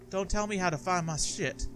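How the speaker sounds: usual speaking voice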